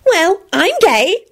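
slow, high-pitched proud announcement; happy. A narrator's reading. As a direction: declare it happily. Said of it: "Flitwick pronounced happily".

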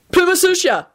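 high, quick-off-the-mark answer; prompt reply. A narrator's reading. Ron answering the quiz card at once, prompt. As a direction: jump in with the answer, pitched high. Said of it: "Ron replied promptly".

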